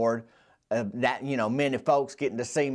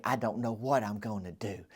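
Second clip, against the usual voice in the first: softer, a touch of worried fluster; mildly flustered; 6 semitones lower, 9 dB quieter than usual. Retold or imitated. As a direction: imitate a fretting grandma, quietly. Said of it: "she flustered like that away".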